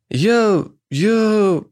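put-on flustered stammering young man; mumbling. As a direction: stammer it out, lost for words. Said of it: "мямлил Иванов".

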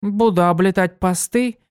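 высокий бойкий девичий голос; звонко и живо, как юная пегаска; бойкая пегаска. Acted. Дитси отвечает. (Дитси рассказывает другу, высоко и бойко)

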